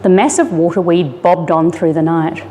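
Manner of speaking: usual speaking voice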